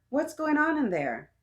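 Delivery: high-pitched call from another room; Mama calling out sharply to a child. A narrator's reading. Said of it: "Mama called out".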